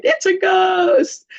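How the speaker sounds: high-pitched, drawn-out mock scream; hammed-up terrified wail; cry of fear. Disciples spot Jesus walking on the lake, mock-terrified cry. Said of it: "cried out in fear".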